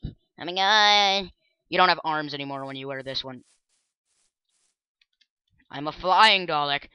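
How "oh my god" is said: surprised drawn out. Real, not acted. Surprised at armless costume.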